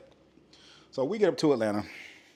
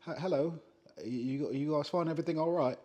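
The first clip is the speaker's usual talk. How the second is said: lightly put-on English accent, a bit higher; mock English accent; 6 semitones higher than usual. Atlanta server greeting their table, mock English accent. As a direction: imitate a server faking a posh English accent. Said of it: "speaking with an English accent".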